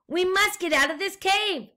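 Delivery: rally the pigs with a brave voice; determined explorer. A narrator's reading. Explorer guinea pig urges the pigs out of the cave. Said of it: "said Fluffy the Explorer".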